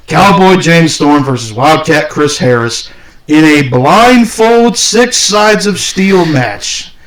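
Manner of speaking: slow, drawn-out match introduction; mock ring announcer. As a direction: build to a high, stretched-out finish.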